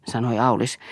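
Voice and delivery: ordinary delivery, nothing marked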